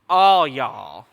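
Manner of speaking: playful attempt at a Southern accent. Pastor imitating colleague Pastor West, playful Southern accent. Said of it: "I can't do as good of a Southern accent".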